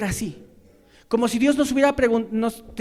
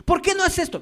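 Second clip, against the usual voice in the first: much higher, pointed accusation; demanding reproach; 10 semitones higher, 3 dB louder than usual. Retold or imitated. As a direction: jab the question at them. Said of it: "demanda algo que no hace".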